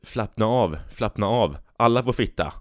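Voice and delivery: "Flappna av" and "fitta" said with an F in place of every S; can't say S. Retold or imitated. Bus driver to passengers, unable to say S, saying F instead. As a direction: swap each S for an F: "Flappna av, flappna av. Alla får fitta.". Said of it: "Busschauffören som inte kunde säga S".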